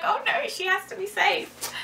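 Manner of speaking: high-pitched, half-laughing fretting; lightly hammed-up nervous squeal; mock nervous. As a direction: play up the worry in a high voice. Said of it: "haven't ever been nervous doing it".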